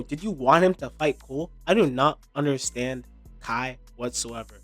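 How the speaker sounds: ordinary delivery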